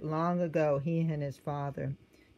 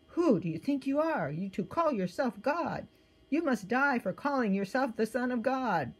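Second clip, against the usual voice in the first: angry accusation; 7 semitones higher than usual. A narrator's reading. Angry leaders accuse Jesus.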